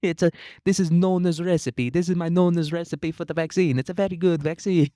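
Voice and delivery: a mock Italian grandpa proudly sharing Nona's recipe in an Italian accent. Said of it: "Italian Anthony Fauci comes out and he's like".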